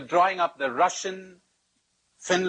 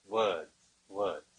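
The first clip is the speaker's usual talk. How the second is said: "words, words" dropped lower and softer, trailing off; fading to quiet; 8 semitones lower, 7 dB quieter than usual. Shown as a demonstration. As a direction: let "words, words" die away into a murmur. Repeats word, fading away.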